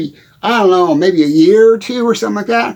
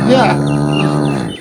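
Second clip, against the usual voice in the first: cow voice. Acted. Answering for the cow.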